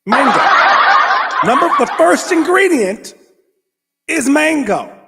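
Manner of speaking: high, loud, laughing announcement; amazed laughing. Real, not acted. Vlogger reading the label to viewers, high laughing exclaim. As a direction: cry it out in delighted disbelief.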